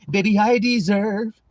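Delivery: mock tearful pleading. Mocks someone pleading they deserve better.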